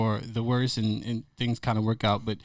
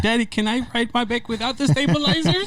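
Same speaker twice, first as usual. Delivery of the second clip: put-on high, childlike voice of a small daughter; little daughter asking; 10 semitones higher than usual. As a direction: pitch it up like a little girl pleading. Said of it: "So she's like".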